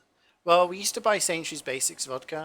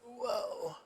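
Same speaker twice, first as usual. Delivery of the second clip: mock whoa; 9 semitones higher, 6 dB quieter than usual. Reaction to first absinthe.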